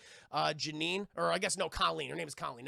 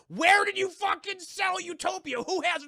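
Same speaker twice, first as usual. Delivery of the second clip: acted angry interrogation, pitched way up; gunman's demand; 11 semitones higher, 8 dB louder than usual. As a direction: bellow the question as an armed robber. Robbers pull guns, demand answer.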